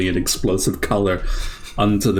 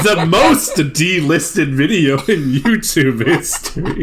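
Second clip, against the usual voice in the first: slow, laughing, louder joke; laughing quip; 7 semitones higher, 4 dB louder, slower than usual. Real, not acted. Host joking the video gets delisted, slow laughing. Joking about being banned.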